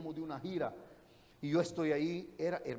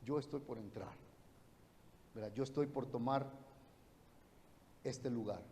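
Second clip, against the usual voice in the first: softer and lower, like a sacred whisper; hushed voice of the Spirit; 6 semitones lower, 8 dB quieter than usual. Retold or imitated. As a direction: let the Spirit speak softly and low. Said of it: "el Espíritu Santo me habló". (el Espíritu Santo hablando en voz baja y grave)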